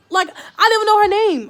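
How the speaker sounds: loud, high burst of disbelief; incredulous exclamation. Real, not acted. Amused disbelief at not knowing name.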